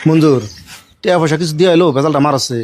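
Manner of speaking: worried aside. Acted. Man admits to Monjur he dreads police, worried aside. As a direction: confide it to your companion, low. Confides fear of police to companion.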